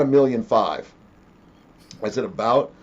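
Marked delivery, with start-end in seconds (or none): 2.2-2.7 "About?" repeated with a rising, doubting tune; incredulous echo. Retold or imitated. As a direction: throw the word back, questioning it.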